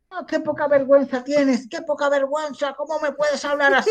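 put-on high-pitched scandalized older lady; señora mayor indignada. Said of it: "era con una señora mayor". (imitación aguda de señora indignada)

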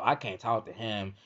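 normal talking voice

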